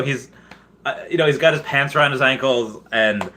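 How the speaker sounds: usual speaking voice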